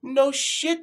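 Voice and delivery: ordinary talk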